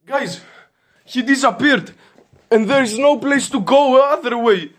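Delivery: high, tense fast talk; rattled, talking it through to the viewers; high excited babble, the threat rushed; agitated. Real, not acted. Confused after intruder vanished.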